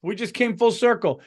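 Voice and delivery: ordinary talk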